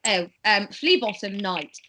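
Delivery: normal talking voice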